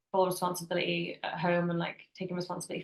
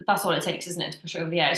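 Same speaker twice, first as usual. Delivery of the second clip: normal talking voice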